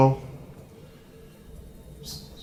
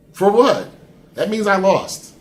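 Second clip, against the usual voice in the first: louder, higher demand, then a slow verdict; indignant disbelief; 7 semitones higher, 7 dB louder, slower than usual. Real, not acted. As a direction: push back hard, then land it slowly. Disbelief at the vague warning.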